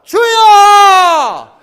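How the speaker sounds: huge high shout, drawn out long; loud prayer cry. Shown as a demonstration. Pastor leads congregation's crying out, sustained full shout. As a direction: holler to God with all your breath. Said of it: "크게 하면 막 한번 해보죠".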